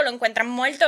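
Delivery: ordinary delivery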